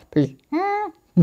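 soft, high-pitched mock bleep; imitated censor bleep. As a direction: cut the swear word off with a high bleep. Bleeps out her own swear word.

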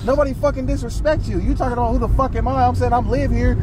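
argumentative retort. Retold or imitated. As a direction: act out pushing back in an argument. Acted quarrel, his reply.

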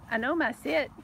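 normal talking voice

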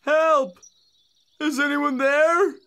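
high, loud cries for help; calling for help. Acted. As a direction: shout for help from the forest. Tin Man calls for help.

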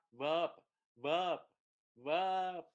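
"verb" with the English-like "ơ" vowel; đọc âm tiếng Anh. Shown as a demonstration. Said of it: "đọc là verb". ("verb" đọc như "vơp")

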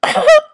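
squeaky high giggle; short shrill giggle. Acted. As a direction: giggle like a smitten kid. Giggle inside acted crush scene.

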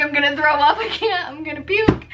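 hammed-up panic, half laughing; mock distress. Retelling a queasy call to dad, mock panicked.

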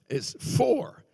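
"for." punched high and loud; "for." said high and loud, hammered home; stress on for. Shown as a demonstration. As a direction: hit the word "for." hard. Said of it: "that word "for," F O R".

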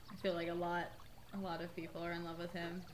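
normal talking voice

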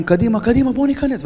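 rally them to storm the house; retold shout. Officer urging comrades to storm in, loud high shout. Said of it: "צועק להם".

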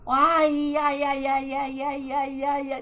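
repeated high wincing ai; stubbed toe. Real, not acted. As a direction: wince over and over.

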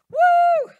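loud high celebratory whoop; shrieking church-party woo. Retold or imitated.

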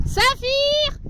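high call again, "Saphir!". Real, not acted. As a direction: call "Saphir!" over the field. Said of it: "c'est honteux".

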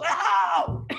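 sudden high yelp of pain; old man on the porch yelping as the log lands; yelled ow. Retold or imitated. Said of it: "and he yells".

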